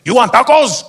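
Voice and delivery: loud, high, drawn-out call; taco vendor. Acted. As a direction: holler it out from the truck. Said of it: "The guy comes to the window".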